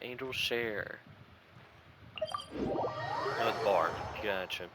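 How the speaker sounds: ordinary talk, nothing marked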